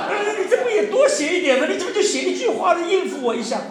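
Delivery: hammed-up hurt mother teasing the son's one-line reply; mock wounded complaint. Mother mocks her son's curt email reply, laughing high whine.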